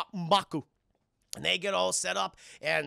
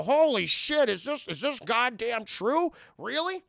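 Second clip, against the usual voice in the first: high, loud, slowed gasp of disbelief; hammed-up shock at the reveal; 7 semitones higher, 6 dB louder, slower than usual. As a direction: react like the floor just dropped out.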